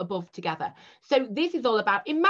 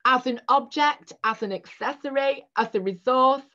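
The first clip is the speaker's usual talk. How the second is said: choking on a drink; 5 semitones higher, 4 dB louder than usual. Real, not acted. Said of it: "I'm now choking on the Evian".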